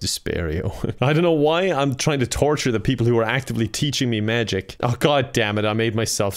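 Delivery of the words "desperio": low, slow, rising spell name; mock ominous incantation. Repeating the depression spell as a dark curse, low. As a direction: murmur it darkly like a curse.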